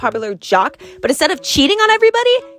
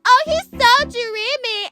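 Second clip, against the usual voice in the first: slow, high swooning sigh; swooning girls; 9 semitones higher, slower than usual. Acted. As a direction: swoon over him dreamily. Ladies swooning over David.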